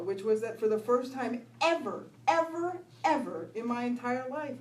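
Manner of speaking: ordinary talk, nothing marked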